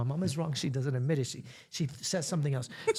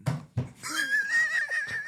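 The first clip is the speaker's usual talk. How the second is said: high shrieking laugh. Real, not acted. Laughing at her mom's dress answers.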